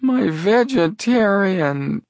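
high, slow, dismayed whimper; slow, high-pitched dismay; despondent trailing off. A narrator's reading. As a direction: draw it out high and crestfallen, leaving it unfinished. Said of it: "voice trailed off despondently".

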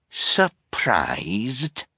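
drawn-out, rising captain's echo; incredulous captain. A narrator's reading. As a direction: repeat it in mild disbelief. Captain echoes the word indignantly.

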